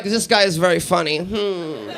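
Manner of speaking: put-on creepy German accent with soft hum after; mock German audience member, humming at the end; deadpan German appreciation. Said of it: "there could be a German guy in the back room".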